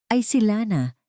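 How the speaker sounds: plain reading voice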